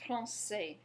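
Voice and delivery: Français confirmed; Français, silent final s; French français. Shown as a demonstration. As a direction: repeat Français for the learner. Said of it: "Go ahead".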